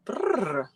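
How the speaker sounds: a lip-trilled brrr from the cold; a real cold shiver sound. Real, not acted. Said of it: "I got cold all of a sudden".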